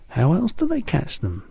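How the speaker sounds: put-on a small girl asking anxiously; high childlike voice; Sophie. A narrator's reading.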